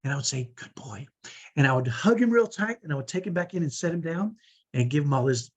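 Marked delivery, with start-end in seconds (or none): 0.5-1.1 low, hushed approval; gentle quiet murmur; soft praise. Retold or imitated. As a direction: praise a child under your breath. Praising child after whispering.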